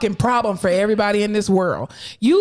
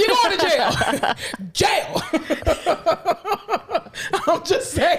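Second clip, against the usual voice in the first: slow, high, laughing; sing-song jail taunt through laughter; teasing the parent who knows the birthmark; laughing mock threat; 6 semitones higher, much slower than usual.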